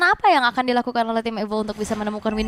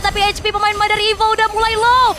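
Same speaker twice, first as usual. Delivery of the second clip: rising, louder hype call; excited play-by-play; 9 semitones higher, 5 dB louder than usual. Real, not acted. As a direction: build the team fight excitement. Caster hypes team fight.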